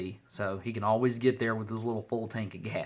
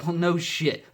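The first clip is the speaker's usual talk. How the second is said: ordinary talk, nothing marked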